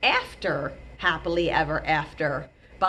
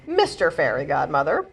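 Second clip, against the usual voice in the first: plain reading voice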